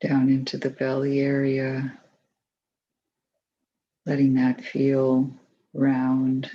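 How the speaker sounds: calm, even voice with long pauses; unhurried, settled meditation leader's delivery; guided meditation. Acted. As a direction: guide them gently through the body, leaving space between phrases.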